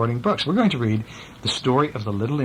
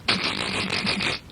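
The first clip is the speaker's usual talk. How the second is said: soft airy giggle; tickled giggle; 6 semitones higher, 4 dB quieter than usual. Retold or imitated. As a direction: act out being tickled. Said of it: "he's kinda going".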